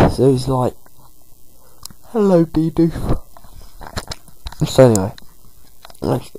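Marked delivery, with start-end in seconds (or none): 2.1-3.2 high, slow friendly shopkeeper greeting. Acted. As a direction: sing out a high, slow hello. Shopkeeper greeting voiced in story.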